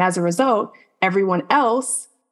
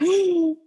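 high, sharp imitated gasp; mock startled gasp; 12 semitones higher than usual. Imitating someone waking from a bad dream, startled gasp. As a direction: gasp as if jolted from a nightmare.

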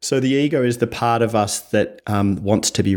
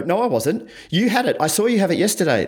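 high-pitched, indignant denial; acted defensive protest; defensive justification; 9 semitones higher than usual. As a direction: argue back defensively, pitch jumping up. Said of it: "that defensive justification type tone".

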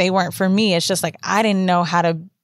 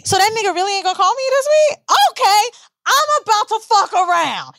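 angry rant; 12 semitones higher than usual. Retold or imitated. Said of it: "so the second one is anger".

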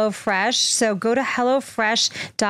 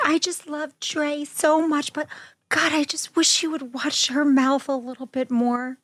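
imitating her mother's voice, higher; mimicking mom; 6 semitones higher than usual. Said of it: "She's like".

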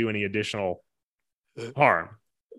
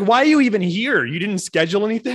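much higher and louder, exasperated; indignant rant; 8 semitones higher, 7 dB louder than usual. Pretend. Ranting at the firemen in the driveway, loud and indignant. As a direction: let the outrage spill over.

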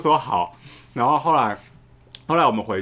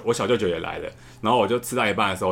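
normal talking voice

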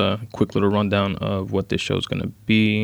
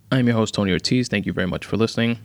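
ordinary talk, nothing marked

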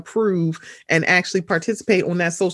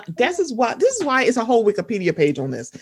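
ordinary talk

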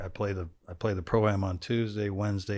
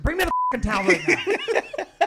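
much higher, cracking yell; exasperated dad losing it. Retold or imitated. Dad yelling at son for the towel, high yell.

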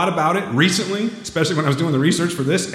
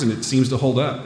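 ordinary talk, nothing marked

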